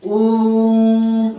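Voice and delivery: ओम held long and steady, the vowel stretched to three beats; long drawn-out Om. Shown as a demonstration. Teacher showing pupils a three-beat vowel, drawn-out ओम. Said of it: "यहाँ पर काफी समय लगेगा".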